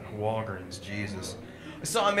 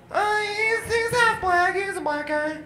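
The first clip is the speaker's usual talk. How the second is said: put-on much higher, timid girlish voice; a daughter confessing meekly; nervous daughter; 13 semitones higher than usual. Daughter tells dad her boyfriend's race, meek and high.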